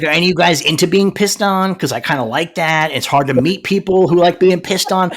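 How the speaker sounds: mock Punisher. Co-host spoofs the character's speech, loud mock earnestness. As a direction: riff as a put-upon oddball, projecting it. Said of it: "He's like".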